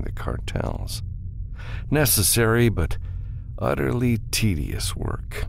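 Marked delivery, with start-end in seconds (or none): none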